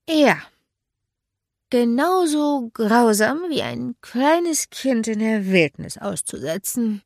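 high, slow, emphatic comeback; defiant retort. Acted. Tipsy narrator answering Mark by the lake, defiant.

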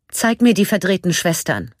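breathless, rushed command; außer Atem. A narrator's reading. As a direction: gasp it out, short of breath. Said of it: "so schnell ging ihr Atem". (atemlos hervorgestoßen)

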